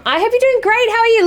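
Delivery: chirpy greeting. Real, not acted. Bright greeting to viewer.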